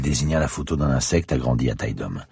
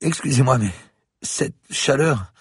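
faltering, slow, fading words; nearly passing out from the heat; fainting; 11 semitones higher than usual. Acted. Del Piero about to faint, struggling to speak. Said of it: "Elle tournait de l'œil".

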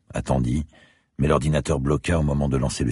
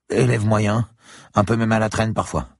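put-on higher, young student's voice; 9 semitones higher, 4 dB louder than usual. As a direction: voice the eager student. Student character voice. (voix d'étudiant plus aiguë)

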